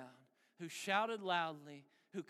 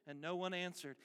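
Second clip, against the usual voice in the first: ordinary talk, nothing marked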